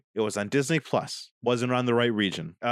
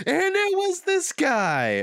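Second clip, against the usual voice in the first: much higher, slow hammed-up reveal; dramatic reveal; 3 dB louder, slower than usual. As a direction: unveil the villain like a game-show host. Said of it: "you would have had this great moment".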